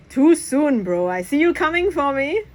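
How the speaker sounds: high playful ribbing; teasing comeback. Acted. Press ribbing his friend back, high and playful. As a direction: rib him back.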